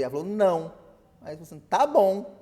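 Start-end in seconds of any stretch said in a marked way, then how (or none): 0.3-0.7 loud, higher-pitched flat-out no; a raised, emphatic veto; firm refusal. Retold or imitated. Acted wife's firm refusal. 1.7-2.4 loud, higher-pitched teasing concession; exaggerated, raised-voice surrender; mock giving in. Acted husband giving in, teasing.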